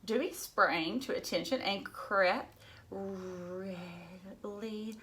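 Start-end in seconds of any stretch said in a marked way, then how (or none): none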